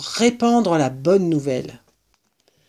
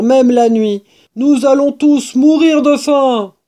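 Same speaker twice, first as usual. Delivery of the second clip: loud, raised, agitated badger voice; high, emphatic outburst; all worked up; 4 semitones higher, 5 dB louder than usual. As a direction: announce disaster with great alarm. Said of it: "tout excité".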